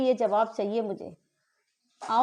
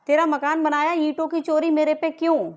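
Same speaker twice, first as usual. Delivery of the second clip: real angry shout, higher and louder; raised-voice indignant challenge; angry demand; 4 semitones higher, 5 dB louder than usual. Real, not acted. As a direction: yell the accusation, demanding an answer. Accusing question repeated as a shout.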